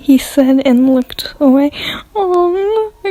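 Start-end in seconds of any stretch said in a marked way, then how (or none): 2.1-3.1 high, restrained little squeal. A narrator's reading. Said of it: "I squealed".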